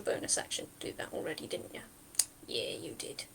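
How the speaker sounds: low whispered aside. Real, not acted. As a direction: whisper it to yourself.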